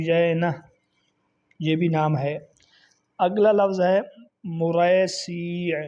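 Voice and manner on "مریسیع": مریسیع said very slowly, pesh on م and zer on س; 极慢地清楚读出مریسیع; slow careful pronunciation. Shown as a demonstration. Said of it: "میم کے اوپر پیش".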